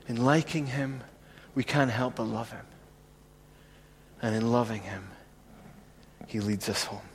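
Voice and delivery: hushed sermon refrain. Acted. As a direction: drop to a near-whisper and let it linger. Quiet meditative refrain.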